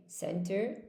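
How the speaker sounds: "Center" ending in -ter; correct pronunciation. Shown as a demonstration. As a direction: model "Center" with final -er. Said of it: "it should be center".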